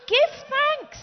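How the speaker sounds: high, loud preacher's call; exclaimed command. Acted. Preacher urges his congregation to give thanks, loud and high. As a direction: burst out with it like a rallying cry.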